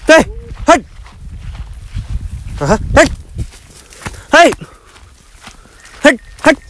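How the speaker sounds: short, very high yelps to drive animals; herding call. Real, not acted. Goatherd driving stray goats to the herd, herding calls. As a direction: shoo the goats with high, sudden cries.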